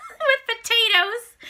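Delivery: high, slow giggly order; laughing. Retold or imitated. Ordering a huge meal from the waiter, laughing. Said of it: "I'm like".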